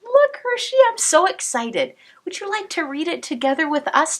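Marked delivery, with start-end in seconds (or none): none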